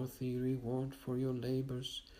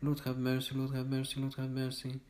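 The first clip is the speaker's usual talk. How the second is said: ordinary talk, nothing marked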